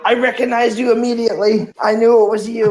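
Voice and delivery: quoting the film's cop in a put-on voice; acted movie line. Movie quote acted, far voice.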